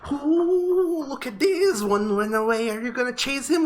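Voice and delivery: very high, squeaky, thrilled little owl; giddy high owl voice; excited owl. Acted. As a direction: chirp it out while hopping. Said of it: "hopping up and down and saying".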